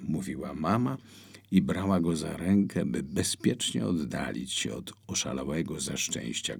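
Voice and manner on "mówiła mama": plain reading voice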